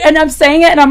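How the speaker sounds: ordinary delivery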